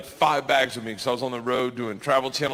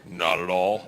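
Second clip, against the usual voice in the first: much lower, dry; deadpan refusal; 10 semitones lower than usual. Retold or imitated. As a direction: say it low with zero interest. Curt brush-off to the woman.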